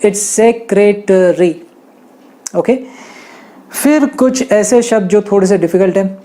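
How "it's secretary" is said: "secretary" said very slowly, syllable by syllable; slow model. Shown as a demonstration. Teacher pronouncing "secretary" very slowly. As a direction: spell out "secretary" slowly with your voice. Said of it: "ए है वो silent नहीं है".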